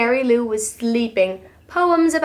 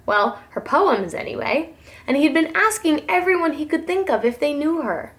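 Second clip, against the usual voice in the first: usual speaking voice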